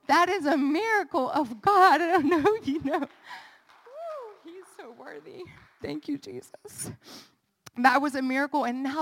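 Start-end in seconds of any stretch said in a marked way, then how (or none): 0.1-3.1 louder and higher, laughing in wonder; joyful praise. Real, not acted. 4.4-6.9 hushed worship. Real, not acted. Hushed praise after outburst.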